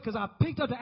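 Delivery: ordinary talk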